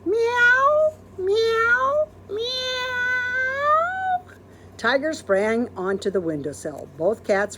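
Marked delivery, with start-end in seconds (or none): 0.0-4.2 high, louder meow; kitty meow; cat meow. Acted. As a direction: mew like a cat. Big cat calling.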